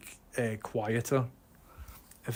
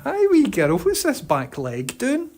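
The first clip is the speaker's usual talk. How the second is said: high dog greeting; 9 semitones higher, slower than usual. Real, not acted.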